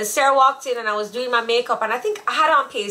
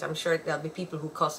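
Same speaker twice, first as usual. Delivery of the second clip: normal talking voice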